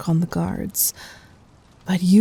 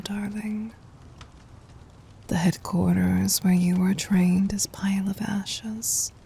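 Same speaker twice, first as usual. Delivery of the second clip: whisper. Acted. Tender intimate reassurance.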